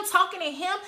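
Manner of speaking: ordinary delivery, nothing marked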